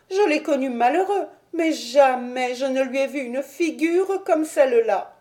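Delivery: put-on high old woman's voice, urgent and fretful; a frightened servant urging her master upstairs; anxious old housekeeper. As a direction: plead with them to go look, voice full of worry. Old housekeeper reports her fear.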